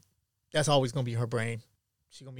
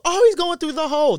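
mimicking the woman; 12 semitones higher, 9 dB louder than usual. Woman casually warning about dog.

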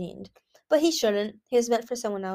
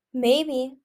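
lilting, higher coy reply; 4 semitones higher, 6 dB louder than usual. A narrator's reading. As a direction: play it coy, pitched up. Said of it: "she said coyly".

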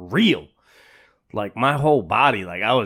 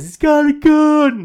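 high, louder, slow alarmed cry; alarmed warning; 8 dB louder, slower than usual. Retold or imitated. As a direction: warn the room someone's armed. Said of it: "Somebody says".

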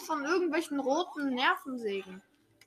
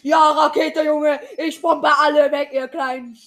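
triumphant shouting; 3 semitones higher, 10 dB louder than usual. Real, not acted. Rocket item blasts rivals.

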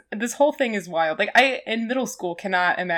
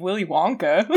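ordinary talk, nothing marked

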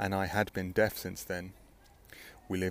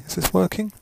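raised, loud question to the mic; loud check; 9 semitones higher, 10 dB louder than usual. Real, not acted. Checking the connection.

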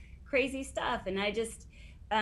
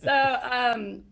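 laughing; 4 semitones higher, 7 dB louder than usual. Real, not acted. Laughing after her frantic quote.

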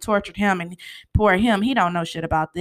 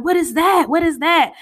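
higher and louder, repeating what is that; alarmed question; 8 semitones higher, 5 dB louder than usual. Retold or imitated. Startled what is that, raised high. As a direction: Mel spots the coke spilling from his bag, alarmed. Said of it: "and she like".

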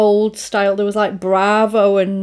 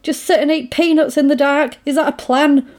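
mock panic; 6 semitones higher than usual. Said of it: "Daffs is going mad".

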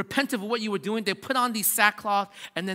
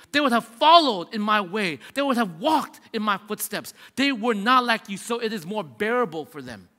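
preaching at full force; 3 semitones higher, 5 dB louder than usual. Acted. Pastor thunders Jesus' warning to the congregation, preaching at full force. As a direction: drive the warning home to the congregation.

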